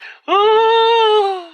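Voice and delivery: high shaking mock sob; imitated shoulder crying. Imitating uncle's shoulder-shaking crying, high mock sob. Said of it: "He cries like this".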